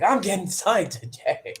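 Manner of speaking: high, slow singsong declaration; mock excitement. Wrestler hanging out alone in his room, mock-excited. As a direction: draw it out in pretend glee. Joking replay of hope, alone.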